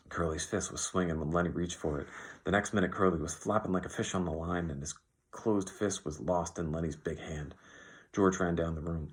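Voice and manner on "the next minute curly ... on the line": ordinary delivery, nothing marked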